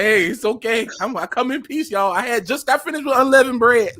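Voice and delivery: high, half-laughing surrender; mock plea. Newcomer begging the chat not to shoot, laughing mock plea.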